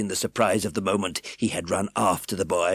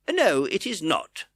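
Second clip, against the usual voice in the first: smiling; 9 semitones higher, 4 dB louder, slower than usual. A narrator's reading. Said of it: "replied the man with a smile".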